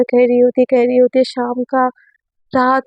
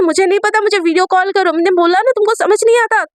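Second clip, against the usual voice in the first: acted yelling; 7 semitones higher than usual. Student yells at teacher to video call. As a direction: shout the demand at her. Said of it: "तुम मुझ पे चिल्ला क्यों रही हो?".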